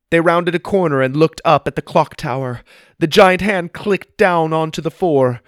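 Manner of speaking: ordinary delivery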